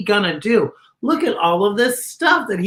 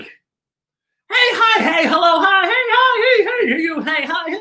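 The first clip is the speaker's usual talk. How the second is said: eager greetings; 9 semitones higher than usual. Acted. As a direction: call out to every passing friend. Frankenstein greets friends, voiced.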